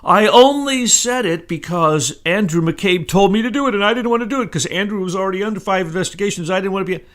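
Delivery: high, loud, hurried mock protest; mock a man babbling excuses, pitch climbing; whiny-excuse caricature, high and rushed; Rat Rosenstein. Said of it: "And Rat came in, like he's been doing all over the news, saying".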